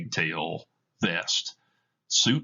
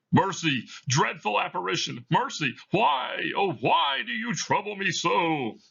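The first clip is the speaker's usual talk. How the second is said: much higher, begging plea; frightened pleading; 11 semitones higher than usual. Acted. Scrooge on his knees before Marley, higher frightened begging. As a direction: beg the ghost for mercy.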